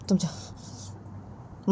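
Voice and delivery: slow whispered go. Acted. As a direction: breathe it out in a whisper. Jiya sends Ritu away.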